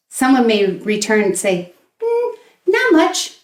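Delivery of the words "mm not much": casual reply. Shown as a demonstration. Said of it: "Very informal".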